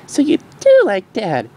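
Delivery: high, slow gleeful filly; gleeful shout. Acted. Dinky teasing her mom, gleeful. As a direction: crow it with glee. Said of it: "shouted Dinky with glee".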